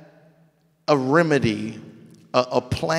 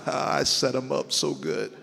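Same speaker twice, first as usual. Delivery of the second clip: gleeful chuckle; 2 semitones lower, 6 dB quieter than usual. Acted. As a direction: imitate a delighted schemer, laughing. Acts God chuckling over his plan.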